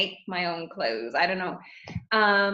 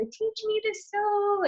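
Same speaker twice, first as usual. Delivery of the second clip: put-on high, slow little-girl plea; pleading child voice; 12 semitones higher, slower than usual. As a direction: beg like a little kid. Retold childhood pleading to seamstress mother.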